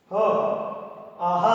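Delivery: ह and आहा ending in an audible breath of h; teacher showing the visarga sound; breathy visarga h. Shown as a demonstration. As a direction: push out a clear ह at the end of आहा. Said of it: "ह कहते हैं".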